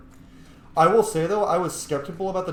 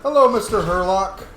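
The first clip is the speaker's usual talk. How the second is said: cheery taunt to a monster, louder; mock greeting; 6 semitones higher, 8 dB louder than usual. Real, not acted. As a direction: greet the enemy with mock politeness. Taunting enemy in game.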